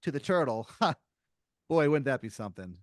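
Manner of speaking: loud, over-friendly small talk; jokey corporate caller. Acted. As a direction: crack a lame joke to break the ice. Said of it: "He goes, he goes".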